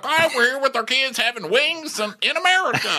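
high, chirpy voice; mock patriotic diner. Diners at the flag restaurant, high chirpy. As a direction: chirp it like a proud tourist. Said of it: "Everyone's like".

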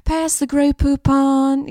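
slow, haughty, posh "Pass the Grey Poupon"; drawn-out mock-aristocrat. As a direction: mimic a snooty mustard ad.

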